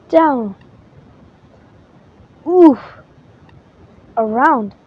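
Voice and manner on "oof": sharp louder oof as a ball hits; winded by the racket; grunt of impact. Acted. Hit by rackets.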